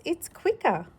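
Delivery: ordinary delivery, nothing marked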